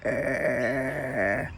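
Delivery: low menacing growl; a low, gutsy warning grunt; mean growl. Shown as a demonstration. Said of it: "Look more mean and dangerous".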